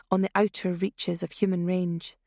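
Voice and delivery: usual speaking voice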